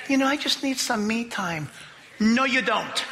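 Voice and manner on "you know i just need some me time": mock self-pity. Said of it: "we gotta get away from ourselves".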